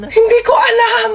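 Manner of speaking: high, drawn-out wailing shout; hammed-up high cry of exasperation; mock exasperated cry. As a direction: yell it back at the mourner. Corpse yells back at mourner.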